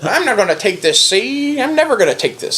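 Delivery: lightly voiced old-time movie tough guy, see?; a hint of a 1930s gangster snarl; thirties gangster villain. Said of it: "like a thirties villain".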